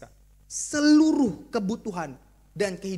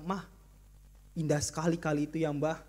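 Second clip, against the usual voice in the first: cheesy romantic husband; 6 semitones lower than usual. Acted.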